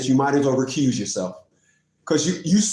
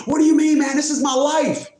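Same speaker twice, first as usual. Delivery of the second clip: mock the client's wounded outburst; imitated indignant client; 9 semitones higher than usual. Offended client objects to being teased, high indignant imitation.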